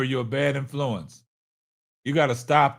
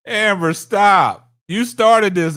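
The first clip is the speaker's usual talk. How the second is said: loud, higher-pitched playful scolding; half-laughing, raised-voice protest; exasperated scolding; 5 semitones higher, 9 dB louder, slower than usual. Real, not acted. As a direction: call her out loudly, stretching the first words.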